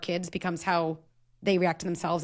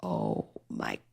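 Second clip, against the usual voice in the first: shocked aside; 10 semitones lower than usual. Retold or imitated. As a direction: gasp at how toxic it sounds. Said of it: "I'd have been like".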